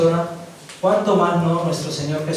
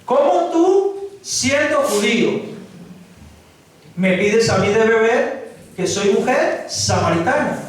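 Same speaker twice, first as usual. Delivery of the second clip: lightly voiced incredulous woman, higher at the start; Samaritan woman; 3 semitones higher than usual. Preacher voicing the Samaritan woman questioning Jesus, mildly incredulous. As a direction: ask it as the astonished Samaritan woman. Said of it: "La mujer samaritana le dijo".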